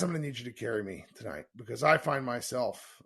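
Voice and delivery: normal talking voice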